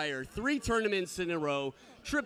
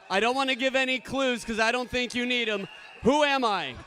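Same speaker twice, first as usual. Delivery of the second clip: ordinary talk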